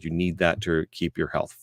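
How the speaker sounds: normal talking voice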